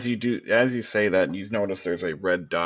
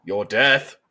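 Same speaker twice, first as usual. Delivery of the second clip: cold reply; 2 semitones higher, 8 dB louder than usual. Acted. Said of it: "And he goes".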